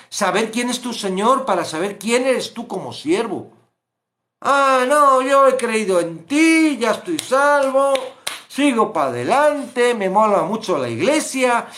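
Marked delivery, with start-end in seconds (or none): 4.4-11.7 high, loud smug churchgoer voice; hammed-up voice of a smug churchgoer; mocking complacent believer. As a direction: parody the self-satisfied believer.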